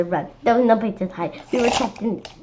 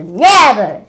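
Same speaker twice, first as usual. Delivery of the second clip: defiant; 11 dB louder than usual. Acted. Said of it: "said Miss Young".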